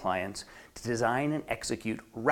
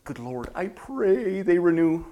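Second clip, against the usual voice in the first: slow, stretched-out pleading; hammed-up prayer, drawing out the key word; mock desperate prayer; 3 semitones higher, slower than usual. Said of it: "never again think or say these words".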